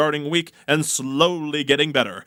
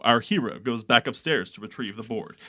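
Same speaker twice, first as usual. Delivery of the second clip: normal talking voice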